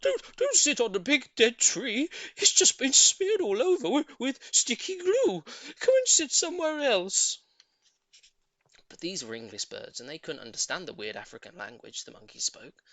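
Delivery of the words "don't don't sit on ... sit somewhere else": monkey voices. A narrator's reading. Monkeys warn birds.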